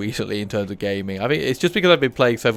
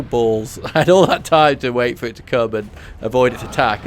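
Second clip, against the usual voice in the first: ordinary talk, nothing marked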